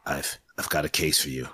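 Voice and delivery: low, hard-boiled narration; noir detective. Acted. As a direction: narrate like a film noir gumshoe.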